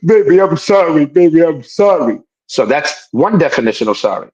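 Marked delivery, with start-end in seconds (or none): 0.0-2.2 laughing, pitched-up mock begging; pleading boyfriend. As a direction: plead like a guilty man, hammed-up. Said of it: "he really fucked up this time and he's like".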